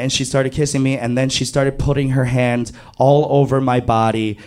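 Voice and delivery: ordinary talk, nothing marked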